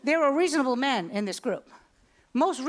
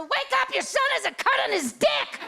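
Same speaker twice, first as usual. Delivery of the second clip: much higher urgent cry; waking husband; 12 semitones higher than usual. Retold or imitated. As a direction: jolt him awake. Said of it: "I get right in his face and go".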